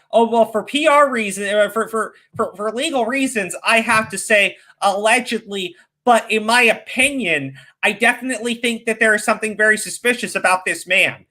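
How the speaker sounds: high, prim mocking voice; mocking prissy commentator. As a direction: sneer like a fake-careful YouTuber. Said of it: "Now it's like".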